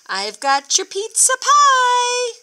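fake pizza man voice. Farmer tricking the pigs as a pizza man, high put-on voice. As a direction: pretend to be the pizza man at the door. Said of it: "Farmer lied".

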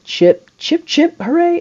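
climbing excited chant into a squeal; rising cheer. Real, not acted. Cheering a batter on.

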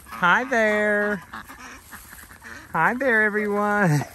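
high sing-song greeting; calling to animals. Real, not acted. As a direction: call a high, drawn-out hello to the animals.

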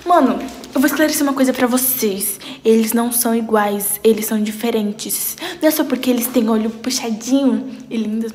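usual speaking voice

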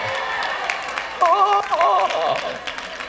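a high, helpless giggle. Real, not acted. Preacher giggling at his own story, high laugh. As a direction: let a high laugh break out.

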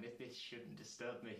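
ordinary talk

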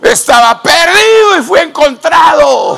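preaching at full force. Acted. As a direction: lift the crowd with a shouted praise. (predicando a todo pulmón)

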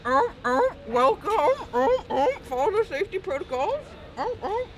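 high barks mixed with words; barking like a seal. Pretend. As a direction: talk through seal barks. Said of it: "That was my seal impression".

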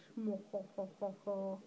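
low mock "mwa-ha-ha-ha" laugh; put-on chortle. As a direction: chortle in low, evenly spaced hoh-hohs. Mock laugh after declaring she's English.

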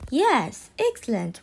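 ordinary talk

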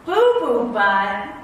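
hammed-up appalled repeat; mock disbelief. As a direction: drag out the silly name in dismay. Reader appalled at silly name.

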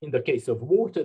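ordinary talk

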